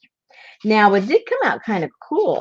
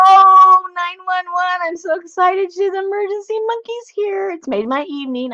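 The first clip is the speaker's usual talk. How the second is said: much higher, thrilled squeal of delight; voice went up excited; 13 semitones higher, 3 dB louder than usual. Real, not acted. Host greeting Emergency Monkey in a real excited squeal. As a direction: let the pitch fly up with real excitement. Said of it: "My voice went up a little bit".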